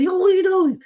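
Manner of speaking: high-pitched, imitated yell at a player; retold yell, voice way up; mock yelling. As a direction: yell it like an exasperated fan. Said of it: "Natural yelling at him".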